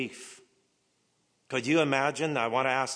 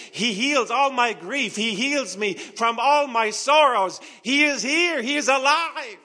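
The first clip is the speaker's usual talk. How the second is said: much higher, louder triumphant proclaiming; full-force preaching; 12 semitones higher, 8 dB louder than usual. Acted. Preacher shouting Christ is alive to the church. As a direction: proclaim it to the rafters.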